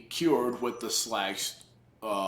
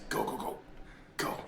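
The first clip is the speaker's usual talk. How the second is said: hushed urging; 14 dB quieter than usual. Real, not acted. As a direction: keep it under your breath while sneaking. Sneaking through game, urging self on.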